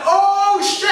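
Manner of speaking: Larry caught in the smoke, shouting in shock. Retold or imitated. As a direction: yell it as the blast goes off. Larry yelling in explosion.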